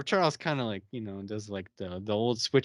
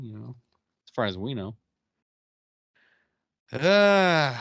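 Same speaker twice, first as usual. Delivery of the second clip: normal talking voice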